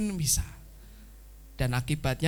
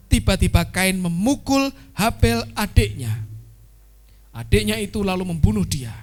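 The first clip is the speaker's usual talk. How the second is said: loud, full-voiced Bible reading; scripture reading; 7 dB louder than usual. Preacher reads Cain and Abel to the church, loud and full. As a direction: read the story out boldly to the congregation.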